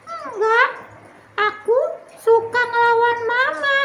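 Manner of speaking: put-on high childish voice; little girl Tiur. Tiur admitting she talks back, in a high child voice. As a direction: confess it like a naughty little kid. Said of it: "Tiur kata sama orang tua".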